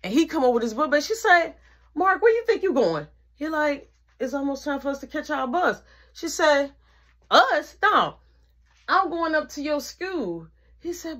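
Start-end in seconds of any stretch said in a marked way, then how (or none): 1.9-10.5 high, sharp imitation of a stern mother; stern mom voice. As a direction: mimic mom shutting him down. Mom confronts brother.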